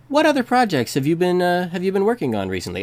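host it with fake gravitas; hammed-up show host question; announcer voice. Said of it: "that ridiculous announcer voice".

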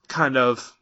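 ordinary talk

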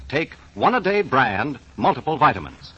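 ordinary talk

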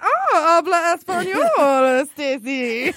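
high, drawn-out mock admiration; mock-impressed teasing. Ribbing co-worker over a few Spanish phrases, mock-impressed. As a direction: tease slowly, laughing on the name.

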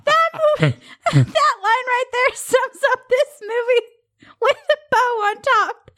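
high, laugh-filled voice that keeps cracking; giggling through every word; real lingering laughter; still laughing. Real, not acted. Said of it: "[name]'s having a heart attack over here".

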